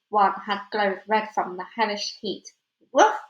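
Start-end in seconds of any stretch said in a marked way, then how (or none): 2.9-3.2 short voiced woof like a small dog; yappy dog bark. A narrator's reading. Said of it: "barked Yuri".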